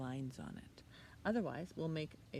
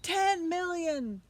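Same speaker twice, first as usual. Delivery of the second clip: slow, much higher, louder shout; 12 semitones higher, 10 dB louder, slower than usual. Acted. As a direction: bellow the offer. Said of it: "shouted the archduke".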